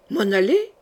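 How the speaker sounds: ordinary delivery, nothing marked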